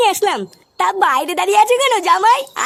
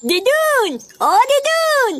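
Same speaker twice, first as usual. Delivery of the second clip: calling out; 5 semitones higher, much slower than usual. Acted. Child calling grandma.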